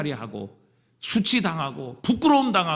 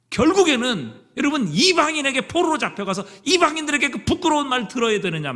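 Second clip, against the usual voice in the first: fervent preaching; 8 semitones higher, 6 dB louder than usual. Acted. Preaching climax.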